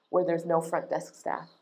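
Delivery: ordinary talk, nothing marked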